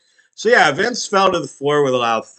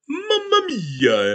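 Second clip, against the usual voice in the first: put-on Italian accent; 12 semitones higher, much slower than usual. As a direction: ham up a cartoon Italian accent. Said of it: "typical Italian stereotype".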